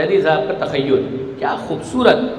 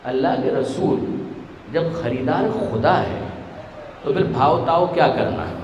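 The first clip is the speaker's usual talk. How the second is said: soft devoted reply; 3 semitones lower, 6 dB quieter than usual. Acted.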